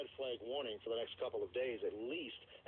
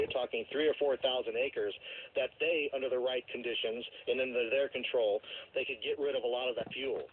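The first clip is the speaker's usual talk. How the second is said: normal talking voice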